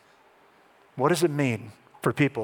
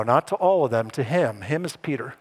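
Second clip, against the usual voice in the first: normal talking voice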